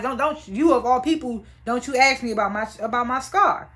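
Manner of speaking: ordinary talk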